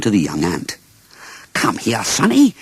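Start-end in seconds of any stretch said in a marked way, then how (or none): 1.5-2.6 calling out. A narrator's reading. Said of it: "he called".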